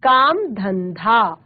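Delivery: काम धंधा slowly, both dh aspirated; careful slow धंधा with a breathy second dh; correct pronunciation. Shown as a demonstration. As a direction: draw out धंधा as "dhan-dhaa". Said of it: "सही उच्चारण है".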